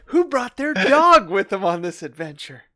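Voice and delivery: loud, high mock indignation; exasperated game master, hammed up; mock outrage. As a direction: demand it loudly, playing exasperated. Mock outrage at barking dog.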